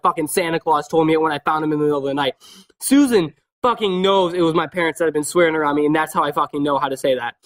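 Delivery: ordinary talk, nothing marked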